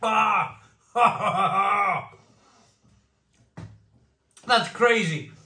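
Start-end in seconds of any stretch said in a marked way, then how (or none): 0.1-0.9 sharp cry of surprise. Real, not acted. Reacting to chili heat. 1.0-2.0 real laugh. Real, not acted. After chili heat.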